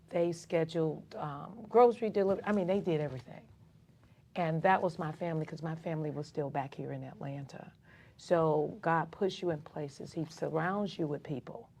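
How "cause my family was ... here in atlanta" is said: whispered aside. Real, not acted. Aside about family far away.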